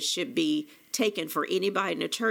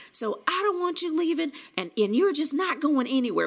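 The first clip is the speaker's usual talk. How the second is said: high, slightly raised mock insistence; hammed-up angry insisting; imitating an angry boyfriend; 9 semitones higher, 4 dB louder than usual. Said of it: "he was still pissy mad".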